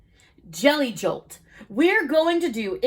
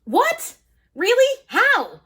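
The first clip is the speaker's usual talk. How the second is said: high astonished cry; surprised; 7 semitones higher, slower than usual. Acted. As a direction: gasp it out in surprise. Jelly astonished.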